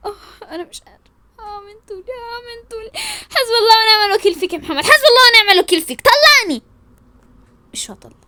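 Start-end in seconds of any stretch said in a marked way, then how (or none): 0.1-2.9 weepy whimper. Acted. Worn out, weepy after fight. 3.3-6.6 fast, high, crying outburst; hysterical wailing. Acted. Wife curses Mohamed and demands divorce, wailing hysterically.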